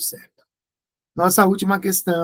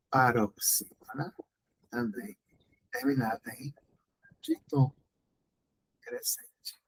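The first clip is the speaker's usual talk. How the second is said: soft murmur; 4 semitones lower, 10 dB quieter than usual. Real, not acted. Quiet aside to students.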